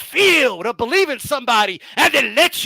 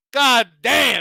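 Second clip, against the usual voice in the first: ordinary talk, nothing marked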